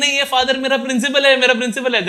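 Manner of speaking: high-pitched, hurried imitation of a boy; insistent boy. Boy insisting the priest is his principal, imitated excited insistence.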